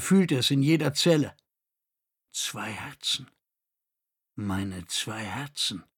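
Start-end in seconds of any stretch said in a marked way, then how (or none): 2.3-5.8 softer, slow and dazed inner voice; a dazed man thinking of his two hearts, softly; dazed thought. A narrator's reading. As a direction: murmur it as if half awake. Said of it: "dachte er benommen".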